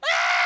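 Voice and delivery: very loud mock terrified scream; imitated kids' scream. First graders fleeing the gorilla, loud screaming. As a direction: scream like frightened little children. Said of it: "they're screaming".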